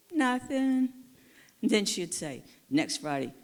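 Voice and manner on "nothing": high, small mock-sheepish answer; sheepish reply. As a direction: answer like a caught kid. Sheepish reply to Rose's nagging.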